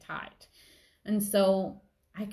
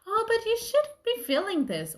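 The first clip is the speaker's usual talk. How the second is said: high, mocking scold of a judging voice; lightly hammed-up judgmental critic; mock scolder; 14 semitones higher, 4 dB louder than usual. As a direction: sneer it like a busybody telling you off. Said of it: "No one can say".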